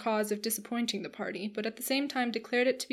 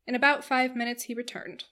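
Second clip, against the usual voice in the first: plain reading voice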